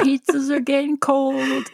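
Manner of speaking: lightly imitated surfer-dude driver; truck driver. Co-host echoing the pizza driver's line, truck driver voice. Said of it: "in the next scene when he's like".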